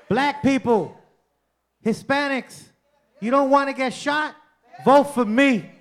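loud, high, punchy candidate appeal; mock campaign pitch. Comic as candidate warning minority voters, loud mock pitch. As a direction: hammer each phrase like a rally threat.

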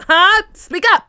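high, loud "Huh? Speak up."; S1 pretending to yell at mumbling Ralph, high and loud; mock yell. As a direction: shout it at a mumbler. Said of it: "he be like".

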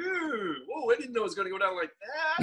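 high exaggerated gasp of wonder; mock surprise. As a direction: act out the fake shock, fast. Everyone's mock amazed reaction.

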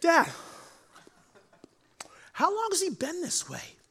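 soft, relaxed, high question; cool and calm. Retold or imitated. Jesus calmly asks the boy's father, soft and relaxed. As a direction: ask gently, cool as a cucumber. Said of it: "he would have just been like".

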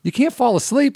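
high mock nurse scolding. Teasing the nurse who woke him, high voice. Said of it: "she leans over and she goes".

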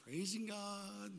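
soft, slow, drawn-out words; hushed, held-back worship; timid worship. Shown as a demonstration. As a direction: praise meekly and quietly. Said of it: "you do the puppy dog thing".

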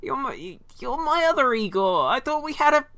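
high, exasperated scientist voice; mad scientist. Acted. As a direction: scold your assistants, flustered. Promo skit, scientist scolding.

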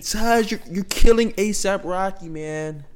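put-on high, slow slurred drunk voice; old drunk voice. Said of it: "That's a whole different voice".

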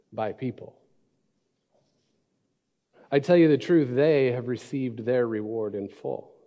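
measured, reverent Bible reading; scripture reading. Shown as a demonstration. Pastor reading Matthew six aloud to the church, reverent.

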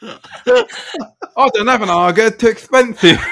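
high, loud hammed-up TV chef; mock celebrity chef voice. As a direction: send up a cheerful TV cook. Continuing TV chef send-up.

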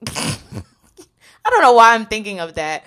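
giggling at herself; laughing. Real, not acted. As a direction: laugh it out, embarrassed.

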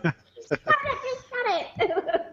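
high, laughing plea; pleading laugh. Retold or imitated. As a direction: beg him to stop, laughing. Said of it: "And I was like".

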